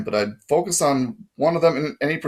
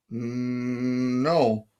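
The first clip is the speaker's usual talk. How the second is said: hesitant; 4 dB quieter than usual. A narrator's reading. Intimidated boy answers the guard.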